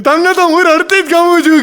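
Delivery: the bride teasing the groom in a high laughing squeal; bride's coy whine. Retold or imitated. Bride's retort, voiced.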